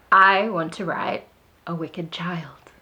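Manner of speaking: dramatic, slow, drawn out; slow dramatic emphasis. Acted. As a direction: drop to a hush on wicked child. Dramatic emphasis on wicked child.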